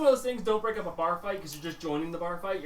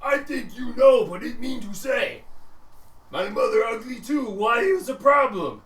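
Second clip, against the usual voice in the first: high, loud, slow ogre voice; bellowing ogre; 8 semitones higher, 9 dB louder, slower than usual. Acted. An ogre arguing about mothers, loud and slow. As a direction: boom it out like a dumb ogre. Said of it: "And he said".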